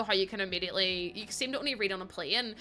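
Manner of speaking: ordinary delivery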